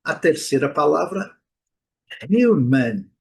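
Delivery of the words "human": "human" with a light h, yoo sound; "human" dito hiumen; English human. Shown as a demonstration. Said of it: "O H com som de R". ("human" com H aspirado e iu)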